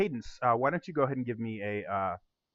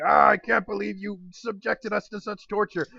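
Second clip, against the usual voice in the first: higher, mild groaning patron voice; disgusted patron; 8 semitones higher than usual. Acted. Patron groans at the man about the show, mildly voiced. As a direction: complain about the awful singing. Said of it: "with people going".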